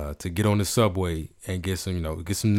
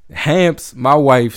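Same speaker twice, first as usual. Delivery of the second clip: ordinary talk, nothing marked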